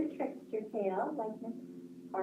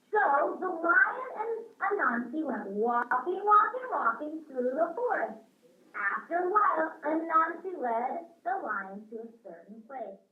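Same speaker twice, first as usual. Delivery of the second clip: storybook reading; 4 semitones higher than usual. A narrator's reading. Reads a story to children.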